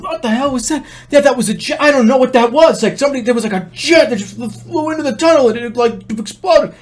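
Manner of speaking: high-pitched frantic relatives, retold; freaking out. Sister and mother after the jet flew into the tunnel, freaking out. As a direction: act out panicked, high-voiced babbling. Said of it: "freaking out. They're going".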